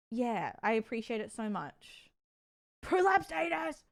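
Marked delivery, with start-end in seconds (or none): 2.8-3.8 random shout. Pretend. Random blurted outburst.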